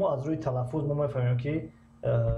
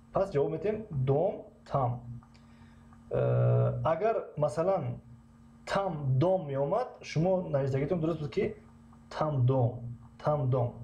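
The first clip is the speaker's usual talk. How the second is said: ordinary talk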